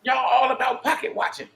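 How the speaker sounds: usual speaking voice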